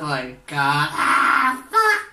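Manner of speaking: ordinary talk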